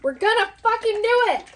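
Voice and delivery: loud pumped-up yell; rally yourself with a big shout; excited shout. Real, not acted. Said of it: "Sorry. Excuse my language".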